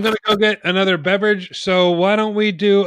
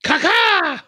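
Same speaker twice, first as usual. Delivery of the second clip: high squawk; 13 semitones higher than usual. Retold or imitated. Imitating the Miz on ropes.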